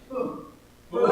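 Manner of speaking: big booming demo. Shown as a demonstration. Storyteller leading kids in a booming boom. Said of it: "say boom in your big booming voice".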